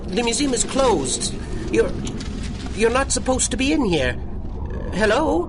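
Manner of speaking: put-on a scared old man trying to sound firm; nervous alarm.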